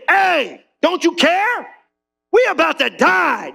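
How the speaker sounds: high, frantic cry of men about to drown; panicked disciples. Acted. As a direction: shout it like sailors sure they'll die.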